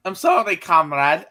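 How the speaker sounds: put-on droid apologizing in a thick Russian accent. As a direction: lay on the Russian accent, comrade. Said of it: "in the most Russian voice".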